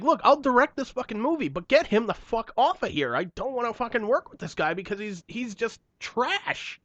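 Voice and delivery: imagined rant to the studio about Michael Bay; heated rant. Retold or imitated. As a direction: vent like a fed-up director.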